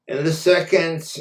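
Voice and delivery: ordinary delivery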